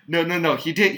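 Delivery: usual speaking voice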